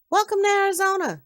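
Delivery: high, loud, quick welcome; bright-eyed host calling out a hearty welcome; enthusiastic greeting. Acted. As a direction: greet the visitor with big, high-pitched cheer. Young man greets lost visitor.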